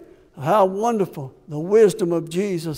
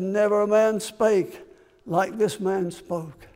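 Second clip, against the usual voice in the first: hushed awe; 2 semitones lower, 6 dB quieter than usual. Acted. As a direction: let it fall to a near hush. Same quote repeated hushed.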